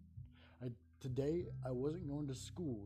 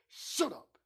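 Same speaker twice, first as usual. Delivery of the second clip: higher, louder snapped order; aggressive; 8 semitones higher, 6 dB louder than usual. Acted. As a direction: snap it aggressively. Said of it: "he said aggressively".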